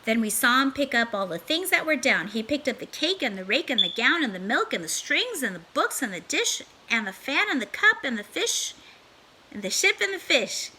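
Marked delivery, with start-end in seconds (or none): none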